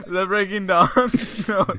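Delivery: high, loud, laughing question. Real, not acted. Friend corrects own film title mix-up, laughing through the question. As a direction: ask it while cracking up.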